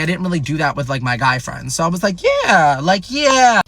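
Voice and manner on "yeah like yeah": higher, louder drawn-out yeah; eager agreement. Retold or imitated. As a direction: agree loudly with rising enthusiasm, retold.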